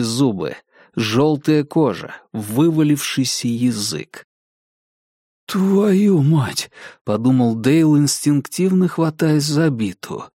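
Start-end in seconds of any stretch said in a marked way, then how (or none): 5.5-6.7 high, jolted outburst; startled curse. Acted. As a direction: jump at the sight. Подумал Дейл, испугавшись. (испуганное восклицание)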